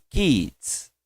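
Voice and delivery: put-on Kids, clear final S; model pronunciation. Shown as a demonstration. Teacher models kids for repetition. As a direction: say Kids crisply. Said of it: "repete comigo".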